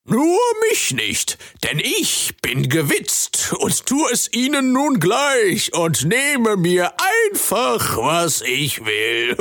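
high, slow scheming frog voice; a selfish frog justifying himself, slowly; sly frog creature. Acted. Frog creature explains to Jamie why he steals, high slow voice.

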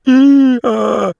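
high, loud hee-haw; donkey bray. A narrator's reading. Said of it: "Nach dem letzten".

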